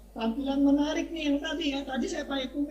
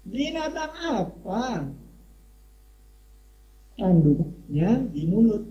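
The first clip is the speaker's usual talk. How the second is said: slow, measured recital with long pauses; pantun recital; 5 semitones lower, 4 dB louder, much slower than usual. Shown as a demonstration. Teacher to webinar guests, reciting a riddle pantun slowly. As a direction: chant the riddle slowly, line by line. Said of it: "Coba tolong dengarkan pantun ini".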